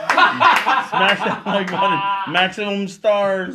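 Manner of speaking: slow, loud hyped YouTuber plug. Pretend. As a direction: ham up the smash that like button. Mock YouTuber outro.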